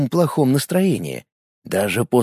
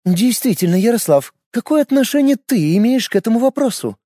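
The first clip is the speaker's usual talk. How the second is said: put-on young woman's voice; 9 semitones higher than usual. A narrator's reading. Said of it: "спросила Шуйская".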